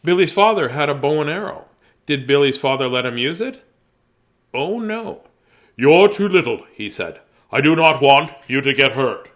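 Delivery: storybook voice; story narration. Acted. Reads the story aloud.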